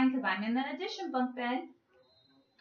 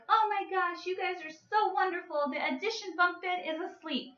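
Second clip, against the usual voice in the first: higher, gushing mom voice; delighted mom; 6 semitones higher than usual. Retold or imitated. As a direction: gush over the kids like a thrilled mom. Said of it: "the mom is like".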